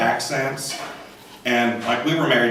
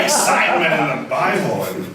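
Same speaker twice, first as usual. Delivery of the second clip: ordinary talk, nothing marked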